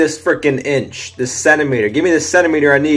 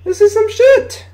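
much higher, squeaky disbelief; outraged squeal; 3 dB louder than usual. Real, not acted. Gamer repeats the complaint at the screen, high outraged squeal. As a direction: shriek it like you can't believe it.

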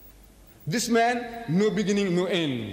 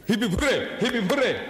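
higher-pitched imitation of a hurrah; mock cheer; 4 semitones higher than usual. Answering what Alleluia means, mock cheering. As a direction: cheer it out in mockery.

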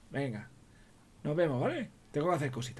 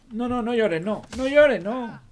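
usual speaking voice

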